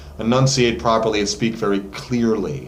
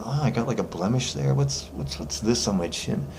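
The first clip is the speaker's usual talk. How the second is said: self-conscious muttering; 6 dB quieter than usual. Retold or imitated. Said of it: "you just start going".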